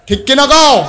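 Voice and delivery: high, loud call to the crowd; rallying shout. Real, not acted. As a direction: demand the congregation's agreement. Calls crowd to respond.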